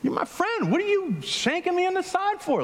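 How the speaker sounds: mock wounded outrage at a friend; surprised betrayal. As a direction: squeak it like your buddy just stabbed you. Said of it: "you would be surprised".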